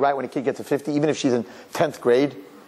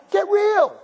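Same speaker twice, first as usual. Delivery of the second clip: high, exclaimed dismissal; scoffing; 3 dB louder than usual. Real, not acted. Speaker dismissing parents' report card worries, high scoffing. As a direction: squeal it in exasperation.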